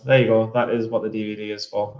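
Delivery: ordinary delivery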